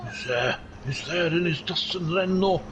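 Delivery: chatty Welsh race commentary; Welsh accent. Pretend. Commentator describing Phil's fight, Welsh accent. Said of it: "this is an accent I've been doing".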